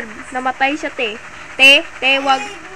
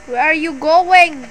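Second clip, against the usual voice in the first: calling out, loud and drawn out; slow, loud, pleading shout to a leaving friend; loud slow call after him; 2 semitones higher, 6 dB louder, slower than usual. Real, not acted. Streamer yelling at teammate who drove away, loud and slow. Teammate driving off, left behind.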